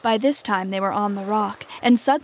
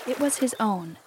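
ordinary delivery, nothing marked

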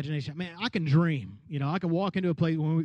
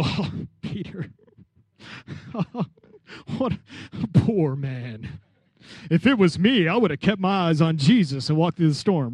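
smug hindsight; 3 semitones lower than usual. Retold or imitated. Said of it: "look at the story and go".

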